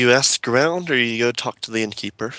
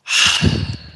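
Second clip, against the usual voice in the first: loud acted exhale; long, breathy sigh; heavy sigh; 4 dB louder than usual. Innkeeper handed gold by an adventurer, heavy sigh.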